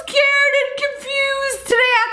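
high, drawn-out words through laughter; helpless high-pitched laughing speech; real laughing fit. Real, not acted. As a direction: squeal it out while laughing hard. Laughing at birthday dog video.

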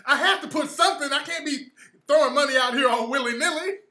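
laughing through the words; giggling as he justifies the note. Real, not acted. Comedian cracking up while defending the charity note.

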